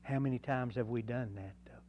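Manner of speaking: softly whispered question. Real, not acted. Preacher's rhetorical aside to congregation.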